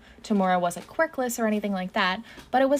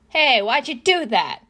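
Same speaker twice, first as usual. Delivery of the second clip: high, loud, outraged question; aggrieved yelling; indignant shout; 5 semitones higher, 6 dB louder than usual. A narrator's reading. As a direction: demand it at full voice. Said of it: "Tamura shouts".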